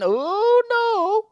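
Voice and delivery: very high, held oh no; high drawn-out oh no; dismay. Real, not acted. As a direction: squeal it long and high in dismay. Dismay at game.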